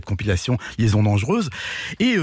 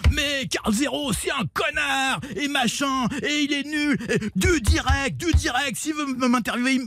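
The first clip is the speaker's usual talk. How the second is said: shouted much higher, slow and hammering; raging rant; 13 semitones higher than usual. Retold or imitated. Standing on the table, Tapie rants at Carl Zero: furious shouting. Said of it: "éructant".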